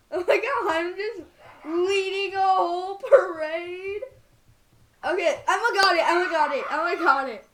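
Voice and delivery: loud, high, laughing panic; laughing shout. Real, not acted. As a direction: shout it while cracking up. Friend chaotically taking controls.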